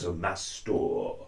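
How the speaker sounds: "master" with a flat American a, slow. Shown as a demonstration. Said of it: "that kind of American accent".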